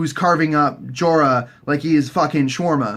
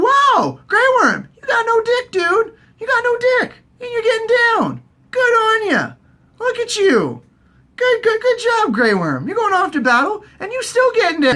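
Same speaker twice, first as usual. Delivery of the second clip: very high, loud, hammed-up congratulations; mock amazement; 3 dB louder than usual. Cheering Grey Worm on, high-pitched mock amazement. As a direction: squeal your impressed disbelief.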